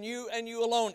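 "you and you alone" pitched high and firm; stress on YOU ALONE. Shown as a demonstration. Preacher telling Christians their job, emphatic "you alone". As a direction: hit both "you"s high, land on "alone". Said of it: "It is emphatic".

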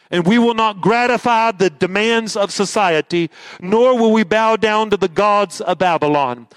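ordinary delivery

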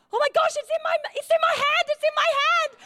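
high-pitched squealing panic; panicked squeal. Retold or imitated. Retelling to church how she grabbed the mouse, panicked squeal. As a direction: squeak it out like you're holding something alive. Mouse caught in bare hand.

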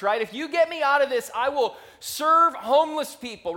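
desperate plea. Pretend. Bargaining with God in a bad spot, loud desperate pleading. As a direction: beg God for a way out.

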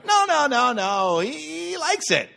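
laughing insistence. Retold or imitated. Local insisting to dad the name is fine, laughing. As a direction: protest through laughter.

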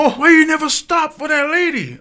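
high, loud mock scolding father; dad scolding. Dad as supervisor chewing out his son: mock scolding. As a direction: scold your son the driver. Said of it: "He was like".

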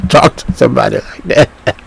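speaking through laughter; friend's amazed words broken by laughs; laughing. Retold or imitated. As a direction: quote the friend while cracking up. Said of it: "[name] fell out laughing".

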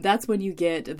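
ordinary talk

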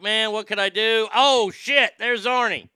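imitating a thrilled listener; excited listener. A listener getting off work finds Arnie on, excited. Acted listener discovering the show.

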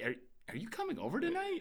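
put-on high, quick kid voice; quick high child asking about a visitor. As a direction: squeak it like an eager child.

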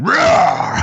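high roaring monster growl; dinosaur voice. Retold or imitated. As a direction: roar like a zombie monster. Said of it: "grabbed him and went".

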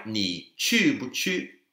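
你去不去 said very slowly, tone by tone; slow careful question. Shown as a demonstration. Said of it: "are you going?".

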